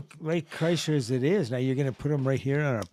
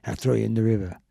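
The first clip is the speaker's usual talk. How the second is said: put-on low Boston accent tough talk; low, casual Boston threat; 6 semitones lower, 6 dB quieter than usual. Cohost copying the demonstration, low in a Boston accent. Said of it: "if you wanna do a Boston accent".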